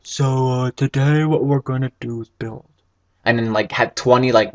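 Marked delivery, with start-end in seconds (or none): 0.0-2.7 mildly dull, empty imitation of a host; blank. Gamer mimicking a boring let's-player, blank. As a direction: play a host with nothing to say. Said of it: "being all blank and like".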